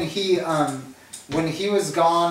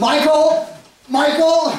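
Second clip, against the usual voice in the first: higher, louder tattle-tale calls; mock calling out; 10 semitones higher, 5 dB louder, much slower than usual. Dwight telling on Jim, calling loudly for Michael. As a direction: shout for the boss like a tattling kid.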